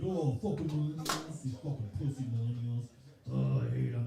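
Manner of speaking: low, grouchy imitation of Dad; grumbling dad. Dad on the couch hating millennials, grumbling. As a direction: grumble low like a disgusted father. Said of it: "that's how he talks".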